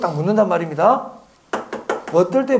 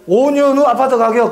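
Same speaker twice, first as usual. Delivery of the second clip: loud, higher, emphatic reading; emphatic pointing-out; 4 semitones higher, 7 dB louder than usual. Real, not acted. Teacher showing students the clue in the question, emphatic. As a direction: hammer out the key clue.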